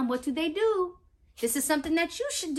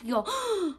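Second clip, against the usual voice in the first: usual speaking voice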